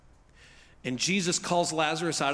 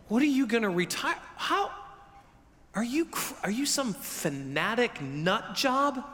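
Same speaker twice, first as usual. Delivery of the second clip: acted incredulity; 3 semitones higher than usual. Reacting to a friend who emptied retirement savings, incredulous. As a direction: question them as if they've lost it. Imagined appalled reaction to donation.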